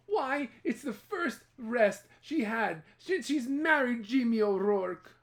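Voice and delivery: slow, high knowing gossip; village gossip. Acted. Village women remarking on Eileen's rest, slow and high. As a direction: drawl it out like a nosy neighbour. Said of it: "the village women said".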